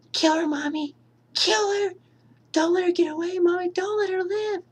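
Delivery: put-on creepy little boy voice; child voice. Voicing child Jason urging mother.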